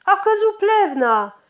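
strigă vestea mult mai sus și mai tare; strigăt bucuros. Acted. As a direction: vestea cea mare, strigată de la ușă. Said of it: "care strigă bucuros". (strigăt de bucurie)

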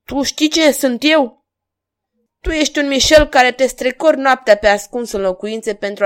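loud, high, heated exchange; angry confrontation. A narrator's reading. King and stranger facing off, indignant. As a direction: challenge with indignation.

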